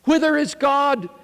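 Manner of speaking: high, loud desperate question; anguished cry. Acted. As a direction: hurl the question at the crowd. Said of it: "he cried".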